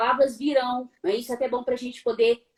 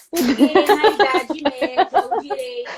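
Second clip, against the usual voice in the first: loud, higher pestering question; acted jittery questioning; 5 semitones higher, 9 dB louder than usual. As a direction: laughingly mimic an over-eager presenter. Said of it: "ficar perguntando assim". (imitando pergunta agitada, rindo)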